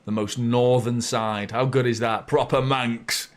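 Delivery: ordinary talk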